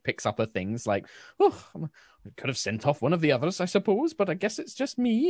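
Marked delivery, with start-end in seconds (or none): 1.4-5.3 put-on gnomish old woman, pitch climbing high; huffy old lady. Old servant gathers her things to leave, huffy rising voice. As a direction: grumble huffily, lilting up at the ends. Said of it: "she kind of huffs".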